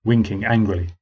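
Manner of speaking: ordinary talk, nothing marked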